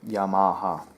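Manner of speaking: Yamaha as 'yah-MAH-ha', on open 'ah' vowels; common pronunciation. Shown as a demonstration. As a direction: say Yamaha with a broad middle 'ah'. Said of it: "That's how people pronounce it".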